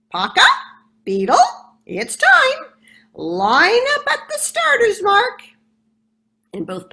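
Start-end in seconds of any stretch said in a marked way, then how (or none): 0.1-5.4 loud, high squawking parrot call. Acted. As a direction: call them out like a bossy parrot. Parrot starting the race.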